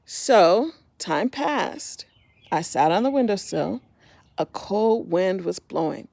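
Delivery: gentle storybook narration; reading to children. A narrator's reading. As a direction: tell it like a bedtime story to little ones. Reading picture book aloud.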